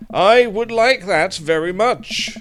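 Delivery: high, loud, slow and emphatic plea; desperate relief. Acted. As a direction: draw out every word, pleading loudly.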